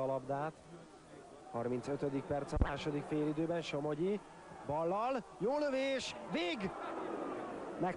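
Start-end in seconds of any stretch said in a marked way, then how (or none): 4.7-8.0 excited shout. Real, not acted. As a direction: pitch soaring on the shot. Commentator on a big save.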